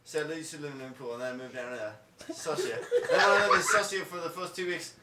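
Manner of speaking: rambling mock British accent; low, muttered attempt at British; hammed-up British bloke, drifting toward Australian; bad British accent. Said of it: "Do British".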